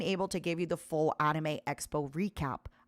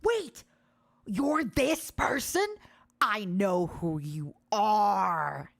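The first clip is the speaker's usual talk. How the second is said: wide-eyed, drawn-out recognition; stunned realization; much slower than usual. Retold or imitated. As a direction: let it sink in slowly, amazed. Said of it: "you would see the realization in my eyes go".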